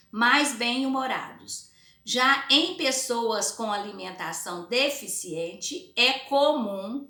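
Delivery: emphatic, drawn-out teacher read-aloud; slow reading aloud. Acted. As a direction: read it out word by word for pupils.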